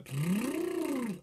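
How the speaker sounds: high tongue and lip trill. Shown as a demonstration. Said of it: "that's a sort of tongue and lip trill".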